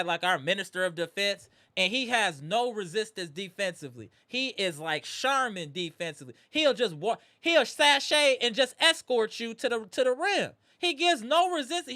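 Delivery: usual speaking voice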